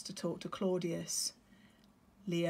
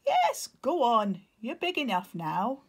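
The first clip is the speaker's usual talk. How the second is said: encouraging father; 5 semitones higher, 8 dB louder than usual. Acted. Nero encouraging.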